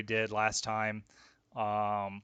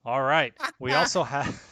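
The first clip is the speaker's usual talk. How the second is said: laughing; 2 semitones higher, 6 dB louder than usual. Real, not acted. Host breaks into laughter.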